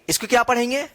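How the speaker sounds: ordinary delivery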